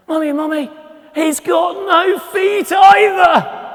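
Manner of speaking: put-on high, loud, slow child's shout; a small boy yelling his discovery. Little boy shouting to his mother, high and drawn out. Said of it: "And then".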